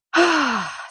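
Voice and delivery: big easy exhale; audible sigh. Real, not acted. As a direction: let out a long sigh of release. Loud exhale to settle the horse.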